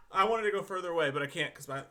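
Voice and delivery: ordinary delivery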